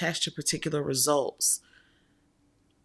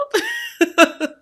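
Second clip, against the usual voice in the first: sudden high laugh, loud; startled giggle; high burst of laughter; 9 dB louder than usual. Real, not acted. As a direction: laugh at yourself in surprise. Surprised at her own tears.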